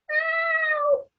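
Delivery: very high tiny-mouse "Ow!"; little mouse squeak. Acted. Mouse stepped on by elephant.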